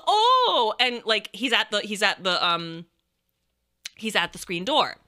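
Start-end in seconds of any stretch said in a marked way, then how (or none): 0.1-0.7 high, drawn-out mimicked "oh"; raised, swooping oh of mock surprise; startled visitor's oh. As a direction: imitate someone taken aback by a dog. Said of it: "she kinda goes".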